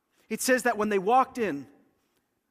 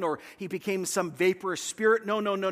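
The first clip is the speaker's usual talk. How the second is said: ordinary delivery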